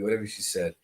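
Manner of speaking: ordinary talk, nothing marked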